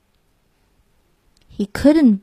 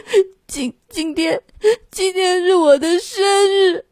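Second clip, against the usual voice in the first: high, broken tearful voice, stumbling on the first word; sobbing lamb; 9 semitones higher than usual. Acted. 小绵羊抽泣着对长脸牛说生日. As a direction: choke out the words through tears. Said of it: "小绵羊抽泣着说".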